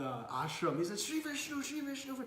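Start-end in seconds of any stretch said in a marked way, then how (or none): 1.0-2.2 high, panicky mock prayer; horrified invocation. Chaitanya flees the ashram, horrified. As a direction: call on the Lord in mock horror. Acted fleeing in horror.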